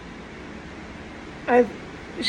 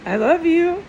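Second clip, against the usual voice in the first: higher, louder call of "I love you"; 6 semitones higher, 3 dB louder than usual. Retold or imitated. Echoing her mother calling to her, called out higher and louder. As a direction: call the words out as if from far away. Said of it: "she was calling to me".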